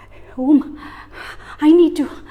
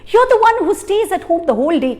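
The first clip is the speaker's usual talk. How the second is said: louder, rushing, accusing husband; angry shout; 3 semitones higher, 4 dB louder, faster than usual. Acted. Husband shouting at his wife over the lost box, angry. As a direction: hurl the blame at her faster and faster. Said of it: "Kamban had never shouted at her".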